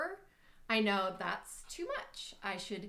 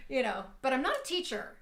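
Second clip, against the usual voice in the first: ordinary talk, nothing marked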